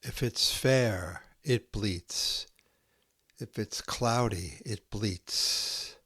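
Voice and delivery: slow, hushed haiku recital with long pauses; poem reading. Acted. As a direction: let each haiku settle into silence. Reads Santoka haiku aloud.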